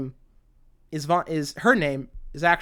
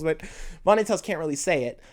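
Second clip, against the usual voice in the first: usual speaking voice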